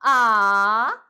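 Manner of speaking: loud dipping "Ah,"; puzzled dip on "Ah,"; confused third tone. Shown as a demonstration. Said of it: "very confused".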